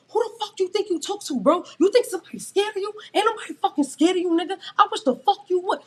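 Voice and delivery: high, loud, fast yelling; screaming. Retold or imitated. She screams at the coworker in front of customers, loud. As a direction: scream him down in fury. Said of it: "now I'm screaming".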